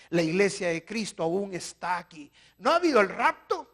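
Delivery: loud, high fervent preaching; full-force preaching. Acted. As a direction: thunder it at the congregation. Preaching climax. (predicación a todo pulmón, fervorosa)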